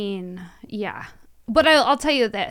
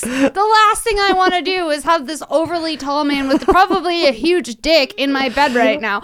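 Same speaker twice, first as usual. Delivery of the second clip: normal talking voice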